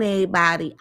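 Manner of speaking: ordinary delivery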